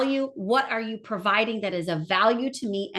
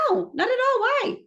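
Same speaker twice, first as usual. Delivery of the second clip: much higher, surprised no-not-at-all; 13 semitones higher than usual. Retold or imitated. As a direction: retell your own startled answer. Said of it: "I said".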